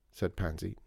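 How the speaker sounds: ordinary delivery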